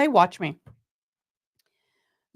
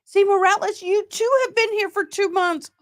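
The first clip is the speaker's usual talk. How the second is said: much higher, bubbling over; excited; 11 semitones higher than usual. Real, not acted. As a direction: gush at Morales in delight. Thrilled greeting to a member.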